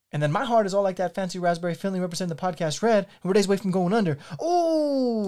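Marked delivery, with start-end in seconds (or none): 4.4-5.3 squeaky alarmed ooh. Acted. Panicked confession erupts.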